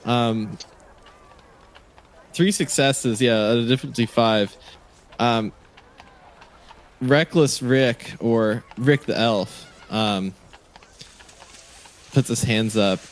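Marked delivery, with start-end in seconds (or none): none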